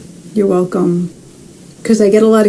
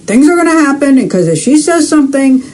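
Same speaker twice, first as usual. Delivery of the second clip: higher, slightly raised voice; mild loud yeller; 6 semitones higher than usual. Retold or imitated. Medium voicing Cheryl's yelling grandmother, mildly loud. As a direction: channel a loud woman, pitched up. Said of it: "she's got a loud voice".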